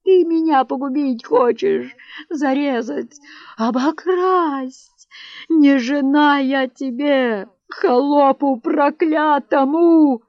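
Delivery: distraught wife's tirade; hysterical wailing. A narrator's reading. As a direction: sob out the accusations. Wife wailing on sofa.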